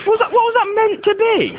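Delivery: very high mocking squeak; squeaky high. Mocking the horse impression.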